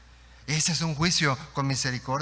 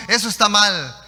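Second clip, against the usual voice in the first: loud, high verdict; acted condemnation; 5 semitones higher, 9 dB louder than usual. You and I judging others, loud acted condemnation. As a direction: pass judgement loudly on someone else.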